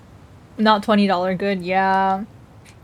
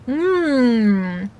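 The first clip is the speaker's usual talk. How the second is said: a fake, showy yum from an ad; overplayed tasting sound like an ad; ad actor mm. Real, not acted. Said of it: "I sound like one of those ads".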